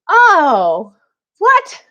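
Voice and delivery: sudden high startled yelp; a loud, high-pitched jolt of surprise; shocked what. Retold or imitated. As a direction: blurt it out in disbelief. Shocked reaction retold from gym.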